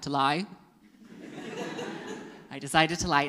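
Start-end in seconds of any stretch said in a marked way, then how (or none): none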